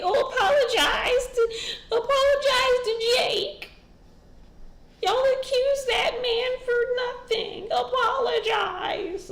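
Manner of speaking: high shrill fake-outraged wail; mocking high whiny voice. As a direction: whine it like a dramatic fan. Fans mocked after turning on him.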